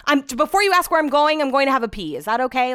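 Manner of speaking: high, loud exasperated announcement; head off the nagging question with sarcasm; annoyed retort. Retold or imitated. Pre-emptive annoyed retort, retold.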